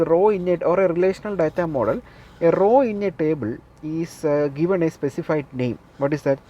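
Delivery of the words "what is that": ordinary talk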